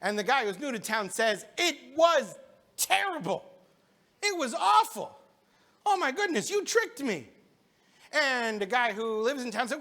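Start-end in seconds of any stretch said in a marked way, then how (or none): 1.6-7.3 higher, aggrieved storyteller voice for the new guy; a hint of an indignant hungry stranger; upset complaint. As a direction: whine that you were tricked. New guy complains about the seder.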